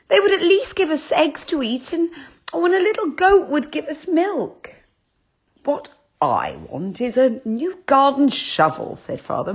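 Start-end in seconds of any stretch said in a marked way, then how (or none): 0.1-4.7 high, dreamy mother's voice; wistful mother. A narrator's reading. 5.6-9.0 father. A narrator's reading. Father voiced in the story.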